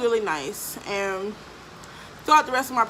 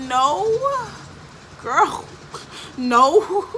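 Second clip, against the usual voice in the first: laughing denial; 9 semitones higher, 4 dB louder, much slower than usual. Retold or imitated. Denying pregnancy while laughing.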